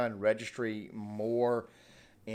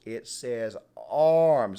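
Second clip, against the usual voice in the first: arms drawn out, slow and louder; stress on ARMS; 7 dB louder, much slower than usual. Real, not acted. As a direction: land hard on arms, slowly. Hammering home the word arms.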